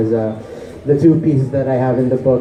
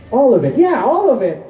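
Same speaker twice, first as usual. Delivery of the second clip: higher, emphatic insistence; insistent repetition; 11 semitones higher than usual. Acted. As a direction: repeat it with rising insistence. Answer repeated insistently after quiet take.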